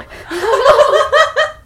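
very high, loud burst of laughter; shrieking laugh. Real, not acted. 笑对方喜欢海绵宝宝. (尖声大笑)

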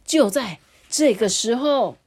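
ordinary delivery

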